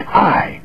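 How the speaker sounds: I lifted high and held; emphatic I. Shown as a demonstration.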